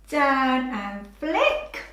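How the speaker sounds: slow, drawn out. Shown as a demonstration. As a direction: narrate the stroke slowly for a child. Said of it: "we'll practice writing".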